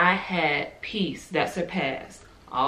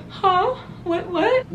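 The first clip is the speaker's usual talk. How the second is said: much higher, bewildered "Huh? What, what?"; baffled surprise; much slower than usual. Retold or imitated. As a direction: pop up high in confused disbelief. Confused reaction to God's words.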